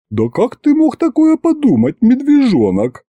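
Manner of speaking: high howling through laughter, mock hurt; воет со смехом. A narrator's reading. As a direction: wail it while giggling. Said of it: "провыл Аристофан". (воет, визжа от смеха)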